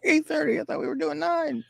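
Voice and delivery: high, startled disbelief; mock surprise. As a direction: squeak it out in shock. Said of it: "I'm like".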